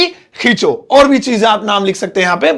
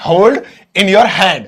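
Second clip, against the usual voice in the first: "hold in your hand" drawn out very slowly; slow teaching demo; much slower than usual. Shown as a demonstration. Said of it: "तो इसे अंग्रेजी में बोलेंगे". (बहुत धीमे, सिखाने के लिए)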